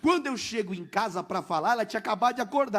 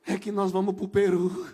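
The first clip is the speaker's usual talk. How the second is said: laughing; 2 semitones lower than usual. Retold or imitated. Revealing news, laughing.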